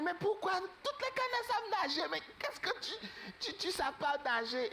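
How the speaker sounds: put-on a small bird asking why, high and childlike; puzzled duckling. Duckling asking its strange brother why he can't swim, high squeaky voice.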